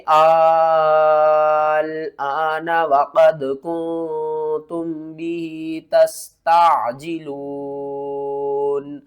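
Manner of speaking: آل آن recited very slowly with a long madd; آل آن as slow, melodic tilawat; correct recitation. Shown as a demonstration. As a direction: recite آل آن stretched to five alifs. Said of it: "تو اس کو کس طرح پڑھیں گے؟".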